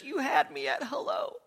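high, soft tearful woman's voice; tearful wife quoting. Retold or imitated. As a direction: mimic her teary whisper of the line. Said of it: "she's crying".

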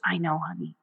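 soothing murmur. Retold or imitated. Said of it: "sitting with her while she was crying".

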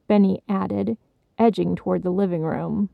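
usual speaking voice

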